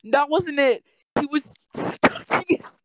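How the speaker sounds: high, loud, hammed-up gossip voice; scandalized retelling. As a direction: pitch it up and loud like a juicy secret. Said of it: "and then he goes".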